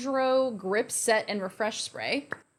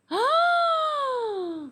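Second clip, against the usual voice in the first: shocked gasp. Real, not acted. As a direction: gasp at the sight in the mirror. First sight of metallic lips.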